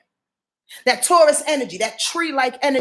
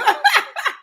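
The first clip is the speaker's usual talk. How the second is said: sudden squealing laugh, much higher; high burst of laughter. Real, not acted. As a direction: break into a high giddy laugh. Laughs after "That's right, Dee Dee.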